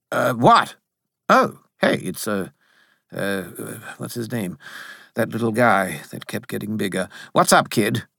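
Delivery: hesitant, lightly voiced spirit; hint of a vague, offhand character; distracted Bob. A narrator's reading. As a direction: fumble for the name, then greet casually.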